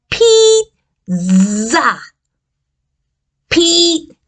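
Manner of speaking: "Pizza" said slowly with a buzzed "zz". Shown as a demonstration. Teacher slows "Pizza" down for the class. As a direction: draw out the "za" in "Pizza". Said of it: "say it slower".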